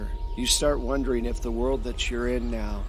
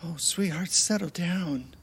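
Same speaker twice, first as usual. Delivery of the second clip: higher, tender worried husband voice; husband gently waking wife; 6 semitones higher than usual. Acted. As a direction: soothe her out of a nightmare. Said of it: "it's your husband in the bed".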